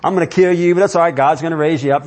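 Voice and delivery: lightly mock a cheerful Abraham; mock breezy Abraham. Preacher mocking a carefree Abraham, breezy and light. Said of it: "Do we think he just".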